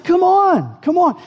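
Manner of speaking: high, loud, eager urging; eager coaxing. Retold or imitated. Son coaxing reluctant dad.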